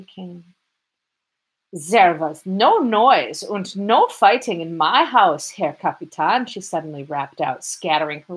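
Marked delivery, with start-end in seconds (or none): 1.7-6.5 put-on heavy German accent, slow and emphatic. A narrator's reading. Landlady protesting to the lieutenant in a heavy German accent. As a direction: protest every word in thick German-accented Russian. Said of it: "with a heavy German accent".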